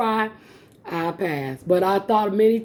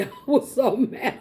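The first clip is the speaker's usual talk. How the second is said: laughing; 4 semitones higher than usual. Real, not acted. Laughing retelling failure.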